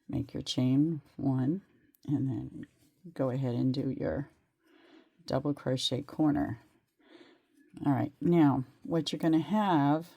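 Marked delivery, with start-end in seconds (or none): none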